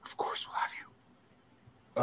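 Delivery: slow, breathless whisper aside; playful stage whisper; conspiratorial whisper. Acted. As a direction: whisper it like a secret plot. Aside offering guest the spot.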